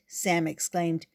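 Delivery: ordinary delivery, nothing marked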